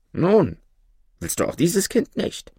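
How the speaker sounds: high-pitched astonished question; astonished judge. Acted. Judge amazed the devil refuses again, high surprise. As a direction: ask in wonder, pitch jumping up. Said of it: "fragte der Richter ganz verwundert".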